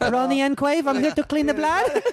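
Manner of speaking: very high mock maid voice. Maid at the door offering to clean, in a high mock voice. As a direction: play the cheery maid at the door.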